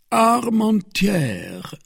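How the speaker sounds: "Armentières" read out slowly, high, with a falling close. Acted. Porthos reading the paper, "Armentières" high and drawn out. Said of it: "read Porthos".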